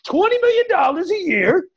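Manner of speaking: high, hammed-up exasperated voice; mock exasperated dreamer. Mocking someone with no plan, high and exasperated. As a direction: play the lazy dreamer, fed up with the question. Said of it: "and they go".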